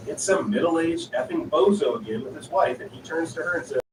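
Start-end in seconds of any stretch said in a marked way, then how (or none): none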